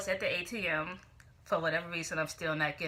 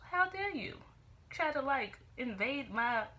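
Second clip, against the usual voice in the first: mock outrage; 6 semitones higher than usual. Vlogger scolding the absent thief, mock outrage. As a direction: start high, as if deeply affronted.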